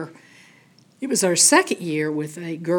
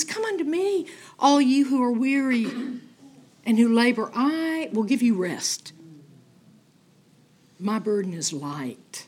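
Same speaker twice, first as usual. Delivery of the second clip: tender invitation; 5 semitones higher than usual. Retold or imitated. Quoting Jesus's words.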